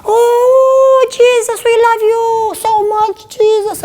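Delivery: very high, loud, slow mock prayer voice; high-pitched prayer. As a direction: parody her squeaky, pious praying. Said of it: "when she prayed, she had a higher pitch".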